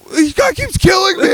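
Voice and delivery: put-on high, crying kid whine; whiny kid voice. Son whining to dad mid-game, high crying whine. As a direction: play a little boy wailing about dying. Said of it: "he's like".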